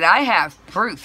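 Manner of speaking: loud, slow, emphatic comeback; retold insistence. Customer arguing back at dispatcher, retold loud insistence. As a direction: insist firmly, each word drawn out.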